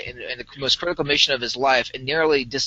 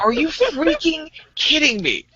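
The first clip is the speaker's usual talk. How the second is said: high, slow, half-laughing outrage; exasperated disbelief; 12 semitones higher, slower than usual. Retold or imitated. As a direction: drag it out in mock disbelief. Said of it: "she is pissed as hell".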